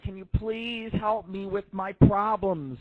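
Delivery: mock pleading. Caller reenacts asking nurses for help.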